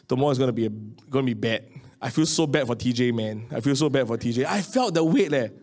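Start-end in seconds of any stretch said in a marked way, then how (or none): 0.1-4.4 lower, sighing despair to oneself; dejected murmur. Retold or imitated. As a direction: mutter it in defeat after a sigh. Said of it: "and like".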